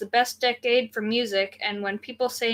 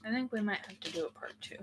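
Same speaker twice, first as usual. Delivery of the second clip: hushed fast whisper; 14 dB quieter, faster than usual. Real, not acted. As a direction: whisper it under your breath. Whispered aside to co-host.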